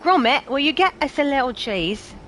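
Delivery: put-on doting old Englishman calling his dog; Wallace impression. Streamer riffing on chat as Wallace, light impression. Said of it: "something that actually Wallace would say".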